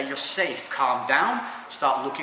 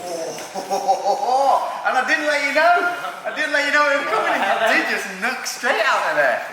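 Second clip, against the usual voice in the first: high, giddy laughing talk; 11 semitones higher, 6 dB louder than usual. Real, not acted. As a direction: laugh it out, surprised. Laughing after being knocked out.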